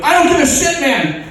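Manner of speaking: high, loud cry for a superhero; desperate citizen hailing a hero; urgent calling out. Acted. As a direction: call for help at the top of your range. Citizen calls superhero for help.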